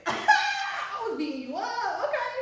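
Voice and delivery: high, slow, laughing mock protest; a laughing, mock-indignant high squeal. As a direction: laugh it out, sending up his story. Mocking his version of the fight.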